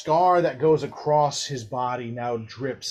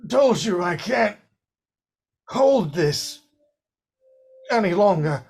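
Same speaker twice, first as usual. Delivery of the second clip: higher, louder, forced through strain; 7 semitones higher, 5 dB louder than usual. Acted. Alexander fighting the demon inside, straining voice. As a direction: hold back something inside you.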